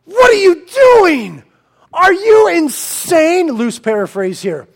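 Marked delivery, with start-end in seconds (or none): 0.0-3.5 high, loud, slow alarmed shout; alarmed angel shouting at a worshipper. Acted. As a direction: yell at them to stop, much higher. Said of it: "the angel goes".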